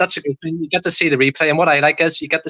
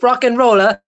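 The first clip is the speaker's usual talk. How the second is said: higher mock catchphrase, lightly imitated; playful higher-pitched imitation; imitating a friend's catchphrase; 7 semitones higher, 4 dB louder, slower than usual. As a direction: mimic a mate's attempt at your accent, pitched up. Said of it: "every time he says".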